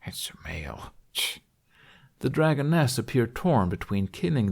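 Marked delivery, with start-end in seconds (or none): 0.1-1.4 soft, low mutter to himself; barely voiced grumble, lower; under his breath. A narrator's reading. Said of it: "repeat under his breath".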